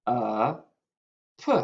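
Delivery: "A, P" as short vowel uh, pause, then puh; sounded out. Shown as a demonstration. Teacher writes the second syllable, sounding out A, P as separate sounds. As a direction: sound out A, P one at a time. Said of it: "The second syllable".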